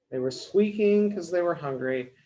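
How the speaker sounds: plain reading voice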